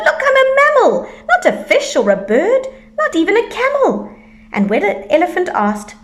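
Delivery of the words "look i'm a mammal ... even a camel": excited shout. A narrator's reading. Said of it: "shouted Fluffalump".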